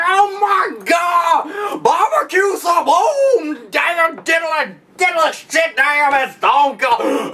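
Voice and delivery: high, shrieking commentator; hammed-up high-pitched announcer meltdown; J R freaking out. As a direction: yell it like your hat is exploding. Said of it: "He'd be like".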